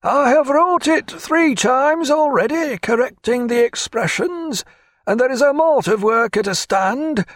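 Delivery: put-on high, grudging voice of a toothless old clerk; sour old clerk. A narrator's reading. Testy old clerk grumbling to his captain about the paperwork. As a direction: complain to the captain as if every task insults you. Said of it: "with no evident sign of pleasure".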